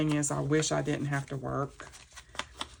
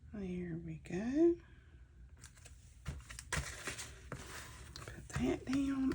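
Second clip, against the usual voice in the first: slow whisper, very softly; whisper to self; 8 semitones higher, 9 dB quieter, much slower than usual. Real, not acted. Crafter whispering to herself while pressing paper down, slow soft whisper. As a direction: breathe the words out slowly to yourself.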